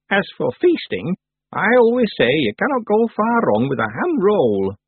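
high, measured character voice; old witch, opinionated. Acted. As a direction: pronounce it deliberately as the old witch. Old witch gives her view.

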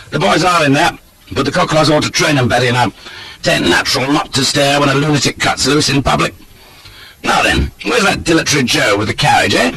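cocky, brisk retort; breezy, unrepentant rogue. A narrator's reading. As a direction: brush off the scolding breezily.

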